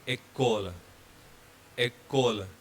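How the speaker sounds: "école" with the final L sounded; French école, voiced L. Shown as a demonstration. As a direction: finish "école" on a clear L. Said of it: "because L is followed by E".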